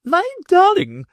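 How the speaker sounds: high, doting mother's cry; fond mother crying out. A narrator's reading. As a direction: gush over her pet child. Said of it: "cried his mother".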